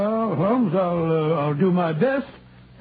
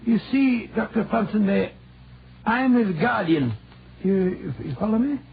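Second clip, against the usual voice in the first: a light put-on Scottish accent, rolling the r's. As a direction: play a canny Scot from Aberdeen, lightly. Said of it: "that delightful Scottish accent you sometimes assume".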